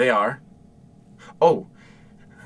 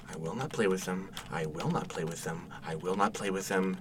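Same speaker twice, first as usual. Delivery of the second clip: soft, low self-reminder, repeated; dad quietly scolding himself; muttered to self; 4 semitones lower, 9 dB quieter than usual. Acted. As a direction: talk yourself out of temptation under your breath.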